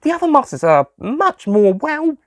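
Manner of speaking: put-on high girl's voice, rising teasingly. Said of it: "Susie said".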